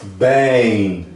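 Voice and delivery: BEM with a long, stretched vowel; drawn-out vowel. Shown as a demonstration. Teacher modelling long vowels for a student, drawn-out BEM. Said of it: "pode ver que prolonga".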